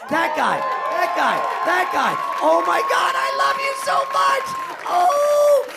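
loud, high, squealing adoration; gushing cat love. Real, not acted. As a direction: gush at your beloved cat. Gushing over his cat.

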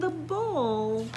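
ordinary delivery

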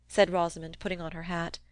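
ordinary delivery

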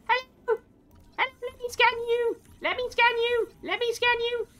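high, insistent calling; eager pleading. Real, not acted. Streamer begging a fleeing creature to be scanned, high. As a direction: chase it with your voice.

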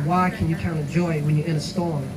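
ordinary talk

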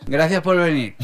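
normal talking voice

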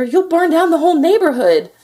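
loud, high alarmed cry; a mother crying out as the roof burns; frantic cry. A narrator's reading. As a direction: cry out in panic. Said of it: "cried his mother".

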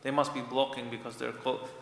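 normal talking voice

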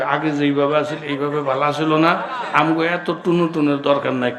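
usual speaking voice

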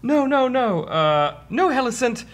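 high-pitched, urgent pleading; frail orc prisoner. Acted. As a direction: plead in a falsetto character voice. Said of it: "And he's like".

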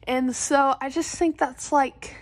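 lip pulled down by finger; talking around a finger; finger in mouth. Real, not acted. Seen 0: Finger presses gum, pulling lower lip down while speaking.

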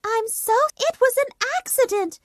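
put-on a child explaining she meant no harm; little girl apologising. Dorothy apologises to the witch, high and hurried.

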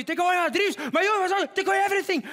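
very high, mock outraged yelling; Greta Thunberg. Comedian showing Greta yelling, mock outrage. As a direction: yell it in a high, overwrought imitation. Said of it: "Toen hij Greta zo zag roepen".

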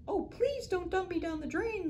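high pleading tiny voice; a small fish begging for its life; little fish voice. Acted. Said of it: "the little voice spoke again".

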